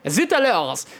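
mock French exclamation; exaggerated French accent. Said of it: "it's my extremely racist French accent".

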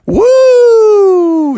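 long, high, loud woo; joyful whoop. Acted. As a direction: whoop it out while leaping high. Kids jumping in low gravity.

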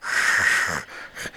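voiceless rasping hiss; imitated animal noise. Retelling what Brody heard in the leaves, voiceless animal noise. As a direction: make the noise of a creature in leaves. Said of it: "I hear it go".

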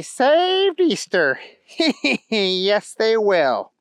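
high, taunting bunny voice with giggles; Trent, gloating villain. Acted. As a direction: gloat over stealing the hero's job.

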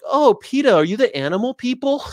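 high, loud mock-curious voice; clueless member of the public. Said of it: "other fifty percent are like".

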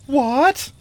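high, loud cry of disbelief; sharp, high-pitched outburst; outraged exclamation. A narrator's reading. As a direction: exclaim it in royal shock. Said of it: "exclaimed Taramis".

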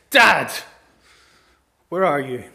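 acted anguished call; desperate cry. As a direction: cry out for your father in distress. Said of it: "you hear the cancer diagnosis and you go".

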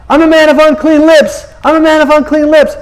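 Isaiah yells his unworthiness from the back; mock shouting. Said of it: "He starts shouting".

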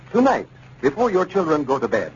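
usual speaking voice

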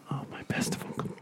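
hushed whisper. Pretend. Jokingly whispering the schoolboy's plea. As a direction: breathe it out as a secret. Whispered mock Latin line.

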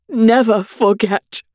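slow, drawn-out never forget; milk the King's horror; King vowing he'll never forget; solemn vow. Acted.

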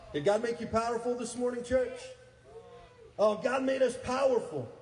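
high, loud rallying cry; full-force preaching. Real, not acted. Preacher rallying the church, shouting praise. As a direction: fire up the congregation.

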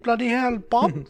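high-pitched mock British exclamation; mock British accent. Host teasing co-host in a mock British accent. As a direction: ham up a silly British accent. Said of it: "do a stupid accent like that".

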